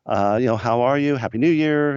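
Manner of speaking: high, delighted hello; thrilled to hear from an old friend; excited greeting. Retold or imitated. Greeting Tony on the phone after their falling out, high and excited. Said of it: "And I go".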